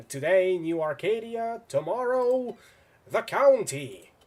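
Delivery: builds to a loud, high shout, slowing on the last words; villain yelling. Acted. Villain announces his conquest plans, excited yell. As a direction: shout the conquest plan, getting carried away. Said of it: "Did I yell?".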